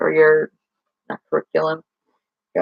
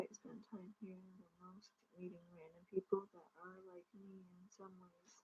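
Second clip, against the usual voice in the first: flat deadpan monotone; 35 dB quieter than usual. Shown as a demonstration. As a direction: say it flat with no feeling. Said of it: "the most monotone, happiest way".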